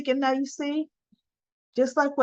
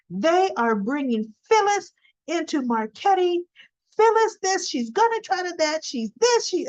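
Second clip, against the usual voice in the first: high-pitched ranting, rising to much higher on each Phyllis this; mock ranting; 8 semitones higher than usual. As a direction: imitate her going off about Phyllis. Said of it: "she just going off on Phyllis".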